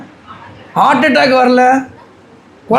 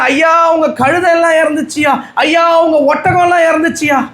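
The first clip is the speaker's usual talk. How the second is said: high shouted servant's report, higher each time; alarmed servant cries; 6 semitones higher than usual. Acted. Servant crying out to Job that everything has died. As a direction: shout the news up to the master.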